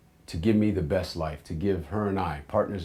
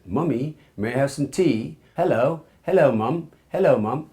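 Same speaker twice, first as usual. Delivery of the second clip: put-on high, posh-kid British English child accent; mock little English kid calling mummy; child's English accent; 6 semitones higher, 6 dB louder than usual. Young son to his mum, in a hammed-up British English accent. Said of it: "I had this English accent".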